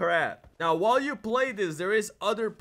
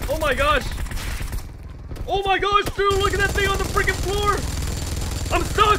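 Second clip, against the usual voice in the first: frantic shouting; 10 semitones higher than usual. Real, not acted. Frantic fight with zombie horde.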